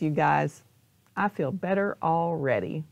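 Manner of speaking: usual speaking voice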